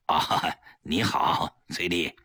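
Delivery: low, slow, strained hello from a gloomy man; forced friendly greeting. A narrator's reading. Gloomy husband forcing a smile at his wife's friend. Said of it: "强打笑脸和崔丽打招呼".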